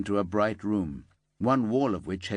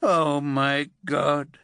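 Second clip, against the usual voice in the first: slow, louder, higher horrified outcry; old man's stricken cry; 6 semitones higher, 5 dB louder, much slower than usual. A narrator's reading. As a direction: cry out in horror at the news. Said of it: "Braithwaite cried out, stricken".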